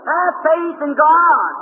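slow, high, loud preacher's cry; drawn-out proclamation. Real, not acted. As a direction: stretch every word out like a call to the whole hall. Healer's exclamation between healings.

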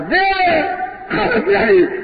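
high calling shout of a name; shouted name from afar; holler. Retold or imitated. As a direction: call out across the street. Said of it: "I heard someone holler".

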